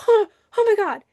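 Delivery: high, loud startled gasp of words; acted fright, pitch jumping up; mock alarm. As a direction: play at panicking in a rocking boat. Acted panic as boat shakes.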